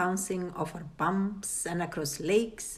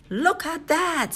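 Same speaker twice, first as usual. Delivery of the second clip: put-on surprised girl; 10 semitones higher, 3 dB louder than usual. A narrator's reading. Cheryl sees candy bar.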